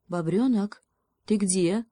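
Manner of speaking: ordinary delivery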